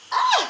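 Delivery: sudden shrill yelp of disgust; high squeal of fright. Real, not acted. Teacher recoils from the spider with a high squeal.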